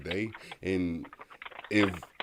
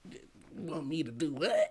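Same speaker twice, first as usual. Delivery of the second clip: Kevin Hart impression; 7 semitones higher than usual.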